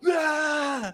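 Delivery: high yelled "Ah!"; scare yell. Acted. Player scaring the bullies with a branch, wild yell. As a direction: yell to frighten them off.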